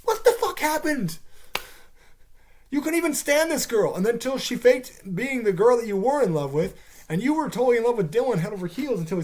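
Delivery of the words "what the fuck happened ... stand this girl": incredulous outburst. Retold or imitated. Reacting to the cliff scene, stunned disbelief. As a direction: drag out your disbelief. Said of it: "I'm like".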